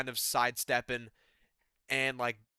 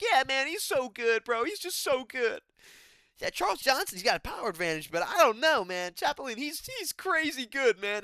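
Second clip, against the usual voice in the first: mocking the commentator; 10 semitones higher, 3 dB louder than usual. Commentator hyping up his training partner, high and gushing. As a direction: ham up a fawning commentator. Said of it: "and D C's like".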